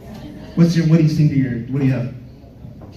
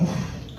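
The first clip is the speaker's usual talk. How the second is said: breathy oof under the breath; a soft, voiceless oof of attraction; unvoiced oof; 11 dB quieter than usual. Comic spotting the cute cashier, soft voiceless oof.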